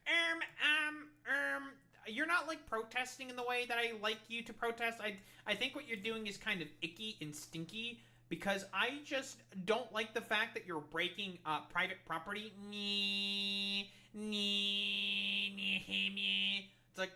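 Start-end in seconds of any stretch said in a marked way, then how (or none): 0.1-12.5 high, whiny mocking voice; nasal-free high sneering mock of a fussy critic; whiny protest referee. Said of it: "protest fucking referees to come and be like". 12.7-16.7 high taunting nee nee; sing-song schoolyard taunt; childish mocking jeer. Mocking the referees' whining.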